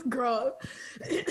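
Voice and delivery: laughing through the word. Real, not acted. Laughing to her friend at how obvious it is.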